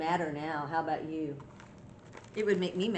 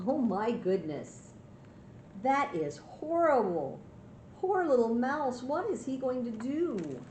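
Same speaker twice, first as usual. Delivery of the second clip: higher-pitched storyteller's concern; playful dismay; 6 semitones higher than usual. Pretend. Grandma pities muddy Mouse for children, playful dismay. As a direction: fret over the poor hero for the children's benefit.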